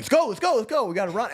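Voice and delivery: loud, high urgent yell to move; urgent calling out. Retold or imitated. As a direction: hurry everyone along, shouting. Officers rushing to a yard fight.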